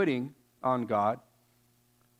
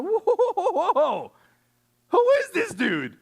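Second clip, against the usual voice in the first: incredulous whoa; 14 semitones higher, 3 dB louder than usual. Retold or imitated. David hearing giant mock God.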